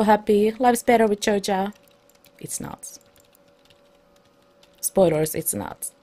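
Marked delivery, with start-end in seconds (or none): 2.4-3.0 whispered aside. Acted. Sarcastic aside. 5.3-5.9 breathy whispered aside. Acted.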